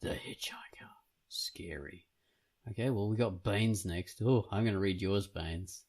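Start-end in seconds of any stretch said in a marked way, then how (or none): 0.0-2.1 hushed, eerie mock whisper; spooky whisper. Co-host echoing the Hitchhiker title, spooky whisper.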